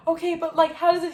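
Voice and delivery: high-pitched flustered questioning; retold confusion, voice shooting up; bewildered. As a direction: sputter it high, imitating your baffled self.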